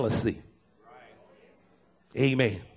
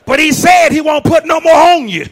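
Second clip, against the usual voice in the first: preaching shout; 10 semitones higher, 11 dB louder than usual. Real, not acted. Preaching climax.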